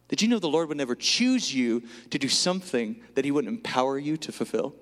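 normal talking voice

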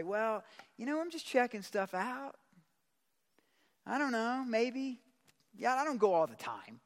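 high-pitched, noncommittal excuse; sheepish hedging. Acted. Acting a hedging believer under pressure.

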